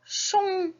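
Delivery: "chon" held alone on a high note; isolated syllable. Shown as a demonstration. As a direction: sound out "chon" high, with a long sh. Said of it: "la syllabe manquante".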